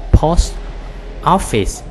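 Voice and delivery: POST, pause, OFFICE, both high and loud; separated words in POST OFFICE. Shown as a demonstration. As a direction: break "post office" into two emphatic words. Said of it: "Mình nói âm qua".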